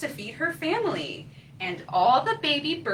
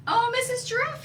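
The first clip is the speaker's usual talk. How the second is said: high eager call; little bird crying out; 11 semitones higher, faster than usual. Acted. Coco calling to Missus Giraffe, high eager cry. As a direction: call out hopefully. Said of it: "he cried".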